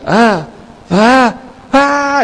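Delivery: "ah" repetidos, cada vez más agudos; gritos imitados. Retold or imitated. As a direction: imita los gritos agudos del que filma el tsunami. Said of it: "el que filmaba decía". (gritos de espanto imitados, en aumento)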